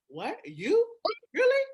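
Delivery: stunned, can't believe it; shocked disbelief. Retold or imitated. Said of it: "I was like".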